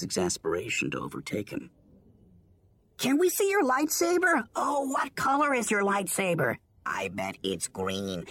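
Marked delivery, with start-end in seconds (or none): 3.0-6.6 put-on excited kid voice. A narrator's reading. Excited younglings pester Anakin. 6.8-8.2 put-on child voice. A narrator's reading. Another youngling guesses.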